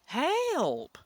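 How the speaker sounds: a high loud cry for help; screaming for help. A narrator's reading. Trapped nephew screams for the guards, high and loud. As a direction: yell Help! as loud as you can. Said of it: "scream as loud as you can".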